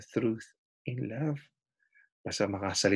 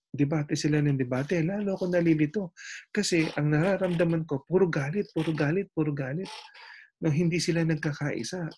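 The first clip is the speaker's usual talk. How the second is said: higher, lightly voiced exasperated driver; confused driver; 6 semitones higher than usual. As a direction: sound fed up with the arguing behind you. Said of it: "Sabi ng driver".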